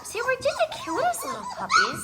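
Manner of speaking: high squeaky puppy voice. Pretend. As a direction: speak as a tiny puppy. Speaking for the puppies.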